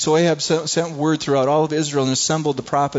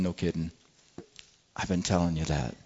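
much softer, dry deadpan aside; dry sarcasm; 6 semitones lower, 6 dB quieter than usual. Acted. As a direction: shrug it off with quiet sarcasm. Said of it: "Elijah's like going".

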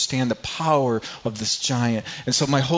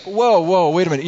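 loud, higher "whoa, whoa" of shock; startled outcry; 7 semitones higher, 7 dB louder than usual. Retold or imitated. Preacher voicing David hearing Goliath mock God, shocked and loud. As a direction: stop everything, you can't believe your ears.

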